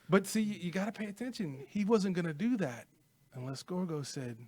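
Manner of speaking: normal talking voice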